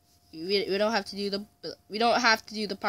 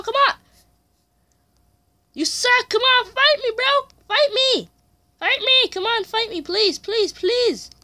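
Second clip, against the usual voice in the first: yelling taunts; 7 dB louder than usual. Real, not acted. Yelling at opponent to fight.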